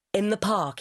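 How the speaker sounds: plain reading voice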